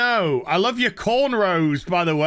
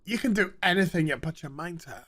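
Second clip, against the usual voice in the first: a softer, rushed, sarcastic reassurance; mock weary encouragement; 2 semitones lower, 6 dB quieter, faster than usual. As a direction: say it like a tired cliché. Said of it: "And they're like".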